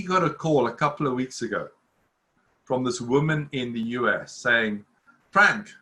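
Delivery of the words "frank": put-on a stab at an American woman's accent, slow and louder; badly faked American accent accusing Frank; mock American caller demanding money. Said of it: "I can't do the accent".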